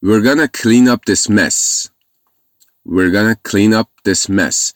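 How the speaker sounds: very slow, careful English sentence; slow model reading. Shown as a demonstration. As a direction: drill the sentence slowly for learners.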